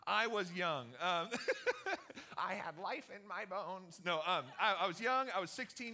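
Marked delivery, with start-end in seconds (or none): 1.3-2.3 a sudden high burst of laughter. Real, not acted. Pastor cracking up at his own story, a high laugh. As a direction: laugh at your own memory.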